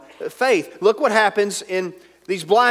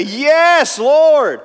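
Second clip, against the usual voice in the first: loud bold answer; 10 semitones higher, 9 dB louder than usual. Shown as a demonstration. Preacher showing the blind men's confident cry to Jesus. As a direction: shout it high and loud. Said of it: "that's not how they said it".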